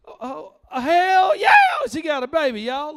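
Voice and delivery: high, loud hollering; bragging dad. Retold or imitated. Dad hollering brag to the crowd, high and loud.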